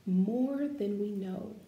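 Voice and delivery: measured, expressive read-aloud voice; gentle picture-book narration; reading to children. Acted. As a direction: read it like a storybook for a class.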